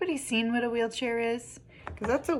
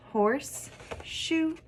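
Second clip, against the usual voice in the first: "horse", pause, high "shoe"; syllable by syllable; 6 semitones higher than usual. Shown as a demonstration. Teacher showing two syllables, broken apart. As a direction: separate "horse" from "shoe", lifting the second. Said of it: "We heard horse".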